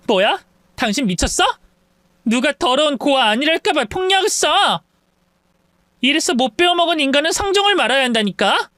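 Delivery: high-pitched, indignant outburst; angry insults. Acted. Slapped sister-in-law insulting her attacker, angry. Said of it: "분노한 동서는 온갖 말로".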